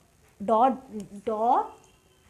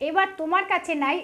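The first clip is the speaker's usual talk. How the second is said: usual speaking voice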